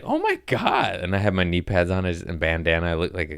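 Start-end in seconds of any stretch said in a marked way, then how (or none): 0.0-1.0 shocked. Retold or imitated. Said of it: "they're like".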